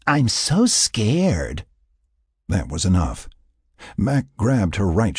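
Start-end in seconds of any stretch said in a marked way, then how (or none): 0.0-1.7 very slow, higher sarcastic taunt; drawn-out mock fright. A narrator's reading. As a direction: feign fear to taunt him. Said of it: "she laughed mockingly".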